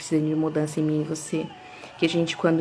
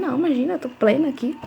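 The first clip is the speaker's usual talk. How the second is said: higher, airy insistence on being calm; mock breezy denial; 8 semitones higher, faster than usual. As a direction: play it light and unbothered, pitched up. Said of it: "Eu falei". (negação despreocupada, mais aguda)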